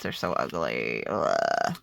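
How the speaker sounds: very low, creaky disgust. Real, not acted. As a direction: drop the voice into a revolted groan. Disgust at yellow Post-its.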